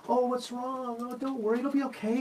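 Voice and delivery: put-on high, sing-song soothing of a crying kid; cooing parent. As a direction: coo like a parent distracting a child with candy. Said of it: "You're like".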